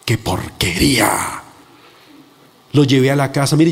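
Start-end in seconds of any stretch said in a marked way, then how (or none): 0.1-1.4 low, very slow sneer of disgust; disgusted accusation. Retold or imitated. Preacher voices the devil accusing him, slow disgust. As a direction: draw it out with contempt, pitched down.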